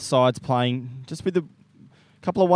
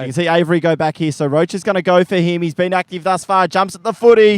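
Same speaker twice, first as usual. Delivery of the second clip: rising, louder play-by-play as the forward leads; excited live call; 4 semitones higher, 7 dB louder than usual. Acted. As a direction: lift the voice and call the mark. Calling a lead and a mark live.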